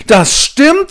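put-on high, loud outraged boy; Paul outraged at his friends; indignant. A narrator's reading. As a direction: burst out in indignation. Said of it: "sagt Paul empört".

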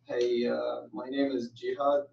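ordinary delivery

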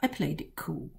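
ordinary delivery, nothing marked

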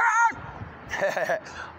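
high squealing laugh; loud high laugh. Real, not acted. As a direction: burst into a shrieking laugh.

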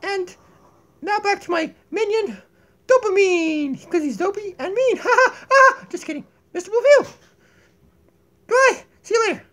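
very high, loud squeaky voice; squeaky cartoon voice. Squeaky host voice.

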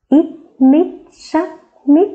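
slow, high, sung-out spelling of ít mít sắc mít; spelling aloud. Shown as a demonstration. Teacher spelling mít for young pupils, slow and high. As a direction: stretch each syllable of mít for the class. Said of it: "Chúng ta đánh vần là M".

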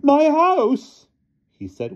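very high, loud anguished wail; sobbing cry. A narrator's reading. Mister Thomas sees his home destroyed, sobbing cry. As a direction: wail it out in grief. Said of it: "He gave a sobbing cry".

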